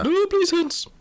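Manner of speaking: flustered lady, high and quick; Prudence McAllister. Acted. Miss McAllister answers the inspector, high flustered lady. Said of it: "Prudence McAllister".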